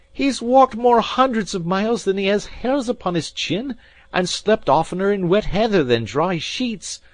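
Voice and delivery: loud, high, raging rant; furious tirade. A narrator's reading. Alan berating the maid, loud and raging. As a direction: keep the fury boiling. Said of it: "with a kind of fury".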